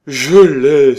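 very loud, high cry of discovery; exclaimed. A narrator's reading. Orator suddenly remembers his opening, crying out. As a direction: burst out as it comes back to you. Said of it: "s'écria-t-il".

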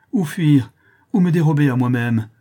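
a man who cannot escape himself; desperate outcry. Acted. The marquis, horrified at his crime, cries out desperately. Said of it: "avec tous les signes du plus grand désespoir".

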